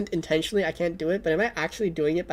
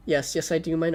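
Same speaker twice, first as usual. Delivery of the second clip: usual speaking voice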